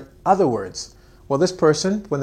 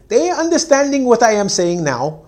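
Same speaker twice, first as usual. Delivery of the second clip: higher, louder mocking of the mistake; mock wrong English; 7 semitones higher, 6 dB louder than usual. Teacher showing the wrong way to say it, higher mock voice. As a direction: say it like a careless learner. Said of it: "So you don't say".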